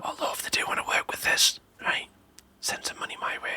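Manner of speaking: hushed conspiratorial aside; whispering. Real, not acted. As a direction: whisper it like a secret. Joking aside to sponsor.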